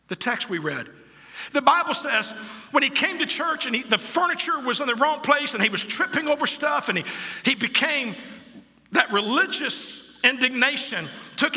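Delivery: high, loud revival preaching; fiery preaching. Real, not acted. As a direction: preach it out at full force. Preaching at full force.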